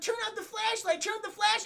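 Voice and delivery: high-pitched frantic bickering; arguing kids impression. Imitating Lex and Tim arguing over the flashlight, high and frantic. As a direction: squeal it like squabbling kids. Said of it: "Lex and Tim are arguing".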